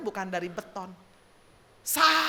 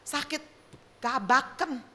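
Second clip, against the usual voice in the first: ordinary delivery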